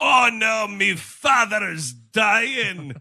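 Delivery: very slow, high, loud wailing; hammy Scottish wail. Pretend. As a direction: ham up a dying-father lament. Parody of movie scene.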